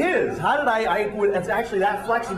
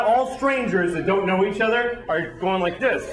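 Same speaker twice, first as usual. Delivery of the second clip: ordinary talk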